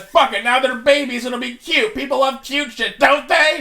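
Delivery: high, loud sneering voice; mocking executive voice. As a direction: mimic a smug studio boss. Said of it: "they just said".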